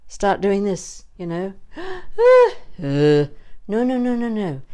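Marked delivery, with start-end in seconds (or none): 1.6-3.6 high, sharp mock gasp of alarm; hammed-up high gasp. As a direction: gasp as if caught doing it wrong. Shows the wrong head movement.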